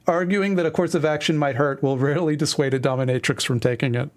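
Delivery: ordinary delivery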